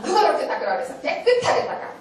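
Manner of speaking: high-pitched, sharp reprimand; stern scolding. Retold or imitated. Teacher scolding a child for careless wiping, stern and high. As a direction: pull the child up on sloppy work.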